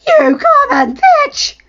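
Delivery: very high, slow, crying shriek; wife shrieking while slapping her rival; screaming insult. Acted. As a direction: shriek it through tears.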